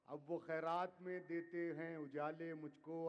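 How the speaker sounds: measured, declaiming poetry to audience; ghazal recitation. Acted. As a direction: recite each couplet with weight. Poet repeats line.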